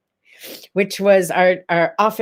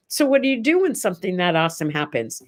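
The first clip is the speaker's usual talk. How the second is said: usual speaking voice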